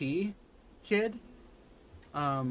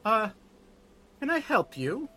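higher, polite caretaker voice; elderly nun greeting; 8 semitones higher than usual. Acted. Elvish nun greeting the adventurers at the orphanage, higher polite voice. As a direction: greet strangers politely, a little unsure.

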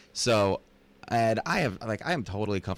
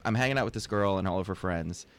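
ordinary talk

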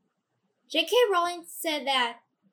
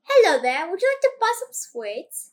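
put-on a shaky British accent, higher and louder, sliding toward Australian; attempted British accent; 4 semitones higher, 6 dB louder than usual. Sibling tries out a British accent for the family, sliding Australian. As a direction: put on a sweet-shop British accent, not quite landing it. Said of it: "try to do a British accent right now".

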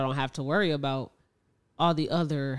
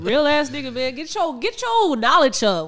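hammed-up swagger; 6 semitones higher than usual. Hamming up his cool persona.

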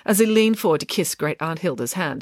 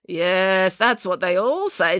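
slow, drawn-out disbelief; dry old-aunt character voice; sceptical great-aunt; much slower than usual. Acted. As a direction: doubt him with slow relish.

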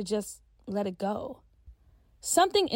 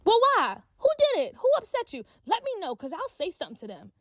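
high, rushed and protective, much higher; riled up; 10 semitones higher than usual. Acted. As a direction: get fired up for your friend. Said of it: "getting all riled up".

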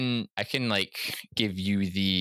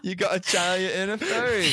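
giggling out the punchline; laughing; 8 semitones higher than usual. Real, not acted. Laughing, high pitched.

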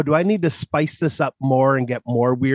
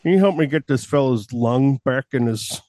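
Buffalo Bill voice; 4 semitones lower than usual. Acted. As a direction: play a strange doctor as a movie creep. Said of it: "Are you gonna talk like that".

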